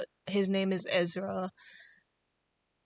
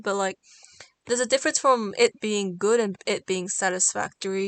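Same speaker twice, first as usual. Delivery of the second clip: flustered ramble; 8 dB louder than usual. Real, not acted.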